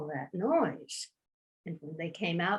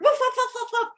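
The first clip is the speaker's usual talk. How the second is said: high, rapid imitated woofs; dogs barking; 14 semitones higher, much faster than usual. A narrator's reading. All the village dogs bark at once, high woofing. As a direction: yap high like a pack of dogs. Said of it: "And they all barked".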